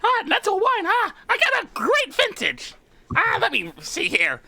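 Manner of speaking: high squeaky kobold host. Acted. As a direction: chirp the offer, pitched high. Charlie offers wine.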